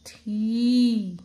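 थी loud, with a long ee; long-vowel demonstration. Shown as a demonstration. Teacher joins letter and matra, long loud थी. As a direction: hold the vowel in थी, loudly. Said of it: "तो क्या बना?".

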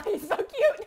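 laughing. Real, not acted. Laughing at a cute panda.

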